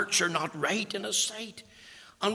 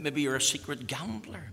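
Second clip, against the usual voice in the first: ordinary talk, nothing marked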